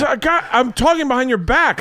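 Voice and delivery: mock-furious yell at a caller; imitating angry comic. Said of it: "He goes".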